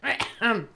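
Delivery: rough throat-clearing cough; smoker's cough. Real, not acted. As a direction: hack out a raspy smoker's cough. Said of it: "my smoker's cough kicked in".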